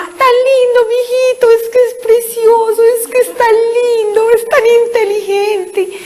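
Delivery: very high, gushing proud mother; doting mother. Retold or imitated. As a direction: coo over the baby like a besotted mom. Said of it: "Y la mamá".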